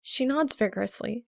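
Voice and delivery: ordinary delivery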